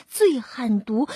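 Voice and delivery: ordinary delivery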